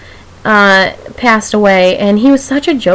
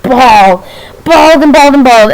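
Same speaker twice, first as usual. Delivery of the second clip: mock-dramatic, raised voice; exaggerated bawling; 2 semitones higher, 5 dB louder than usual. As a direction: punch out each repeat, hammed-up. Acting out how hard she bawled.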